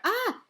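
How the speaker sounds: sharp high yelp as he pitches forward; a sudden frightened "Ah!"; startled cry. A narrator's reading. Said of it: "he called out".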